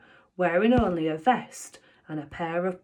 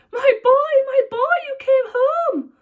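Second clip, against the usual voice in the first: overwhelmed father voice; tearful dad; 8 dB louder than usual. Acted. Father red from crying welcoming son home, high and tearful.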